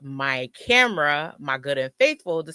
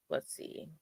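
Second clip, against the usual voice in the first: whispered; 15 dB quieter than usual. Real, not acted. Thinking aloud, unvoiced.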